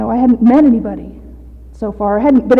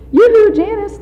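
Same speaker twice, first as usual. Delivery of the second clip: sing-song call; 10 semitones higher, 4 dB louder than usual. Retold or imitated. Voicing sponsor's sing-song call.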